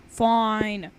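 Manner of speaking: usual speaking voice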